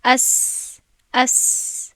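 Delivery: اس with a long, easy hissing س; sustained s. Shown as a demonstration. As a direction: let the س stream out slowly. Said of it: "مثلاً".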